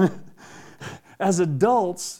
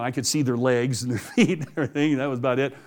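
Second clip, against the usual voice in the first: usual speaking voice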